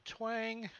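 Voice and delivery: crossbow twang made with the voice; mouth twang sound. Acted. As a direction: snap out a bowstring noise. Crossbow shot sound effect.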